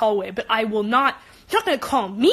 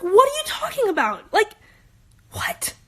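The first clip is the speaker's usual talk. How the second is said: higher, exasperated disbelief; 8 semitones higher than usual. Retold or imitated. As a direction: throw it back at him in shrill disbelief. Retold exasperation at classmate.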